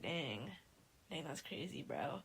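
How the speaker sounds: low, hushed imitation of an onlooker; understated reaction. Imitating Lily watching Becca held under, quietly understated. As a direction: say it low and soft, barely reacting. Retelling a bystander's idle reaction.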